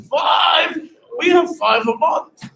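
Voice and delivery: high, loud mock outrage, then stretched out; imitating an incredulous club owner; mock indignant disbelief. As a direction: echo the number as if appalled. Club owner's indignant retort, retold.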